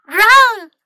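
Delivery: high, loud squeak of alarm; squeaked warning. A narrator's reading. City mouse warning her friend, loud squeaky cry. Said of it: "City Mouse squeaked".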